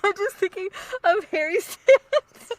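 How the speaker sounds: high, loud, slow words through real laughter; cracking up helplessly while explaining; trying not to laugh. Real, not acted. Co-host explains what set her off, laughing. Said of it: "I'm trying so hard not to laugh".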